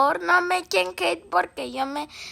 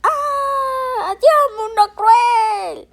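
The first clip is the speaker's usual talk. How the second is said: loud, high, slow dying cry; hammy death scene; 9 semitones higher, 8 dB louder, much slower than usual. Pretend. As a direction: overact a toy falling to its doom. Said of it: "es sonido de mi hermano".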